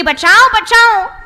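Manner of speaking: drawn-out mock cry for rescue; mother-in-law calling from the river, drawn out; cry for help. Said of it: "जोर जोर से चिल्लाने लगी".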